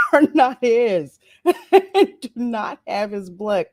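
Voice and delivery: can't keep a straight face; laughing through it. Retold or imitated. Retelling the queen's words, laughing through them.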